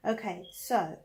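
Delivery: ordinary delivery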